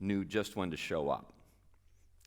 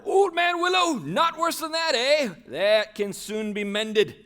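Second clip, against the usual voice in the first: put-on high, loud and slow merry old man; jolly old Tom; 10 semitones higher, 11 dB louder, slower than usual. Tom promising to mend Old Man Willow, high loud jolly. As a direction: declare it like a cheerful fixer. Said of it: "shouted Tom Bombadil".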